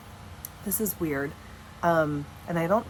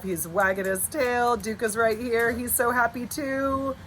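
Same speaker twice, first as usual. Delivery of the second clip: usual speaking voice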